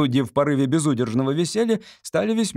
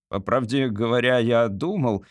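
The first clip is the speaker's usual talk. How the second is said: usual speaking voice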